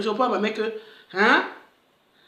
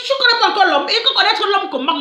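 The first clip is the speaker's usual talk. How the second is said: high, fast, rising disbelief; excited mock exchange; 9 semitones higher, 4 dB louder than usual. Retelling the mother's question with rising disbelief. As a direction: fire it back higher and faster. Mother's and daughter's lines retold.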